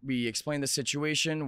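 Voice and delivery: ordinary delivery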